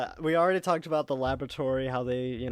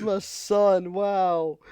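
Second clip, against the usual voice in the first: mock emotional father; 4 semitones higher, 4 dB louder, much slower than usual. Big Smoke quoted again.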